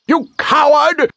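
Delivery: very high screech of contempt; shrill reviling cry; sneering insult. A narrator's reading. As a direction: spit it out as a tiny furious creature. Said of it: "it sneered".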